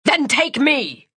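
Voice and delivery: high, drawn-out angry shout; heated narrated shout. A narrator's reading. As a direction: yell it at the police, stretching the words. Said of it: "Emery shouted".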